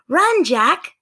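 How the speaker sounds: high, loud mock alarm; playful alarm. Sister urging brother to flee, high loud mock alarm. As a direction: sell the pretend danger. Annie fleeing pretend monster.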